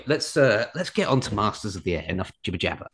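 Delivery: ordinary talk, nothing marked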